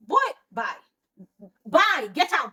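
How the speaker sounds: acted-out indignant brush-off; retold angry dismissal. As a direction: dismiss him sharply in the retelling.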